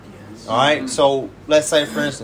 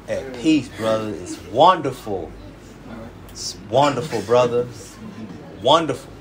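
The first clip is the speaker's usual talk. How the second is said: mock serene brother; 4 semitones lower than usual. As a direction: imitate a blissed-out brother. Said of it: "This is how they talk".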